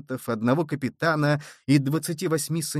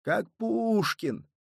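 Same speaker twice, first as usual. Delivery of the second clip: slow, drawn-out, higher; drawled; 7 semitones higher, much slower than usual. A narrator's reading. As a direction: stretch the words out slowly. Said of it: "протянул раненый".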